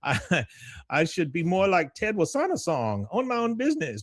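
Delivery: high-pitched complaining husband; Khan. Retold or imitated. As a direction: lament it in a high cartoon voice. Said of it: "He goes".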